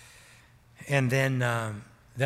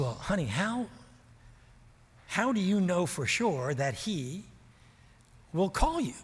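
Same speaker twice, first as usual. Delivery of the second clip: gently doubtful wife asking her husband; a hint of the wife; 5 semitones higher than usual. Wife Sandy voiced asking doubtfully.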